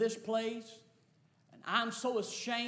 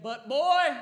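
loud, raised father's call; calling back; 6 semitones higher, 11 dB louder than usual. Retold or imitated. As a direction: call out firmly to your boy. Father calls back to son.

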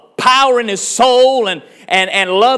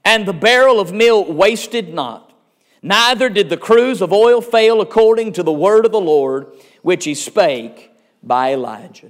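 scripture reading; 3 semitones lower than usual. Acted. Reading scripture aloud in sermon.

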